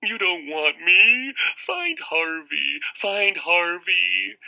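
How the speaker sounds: high, slow tearful whine; whining sobs. Acted. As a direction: blubber and whine. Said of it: "Wendell whined, all snot and tears".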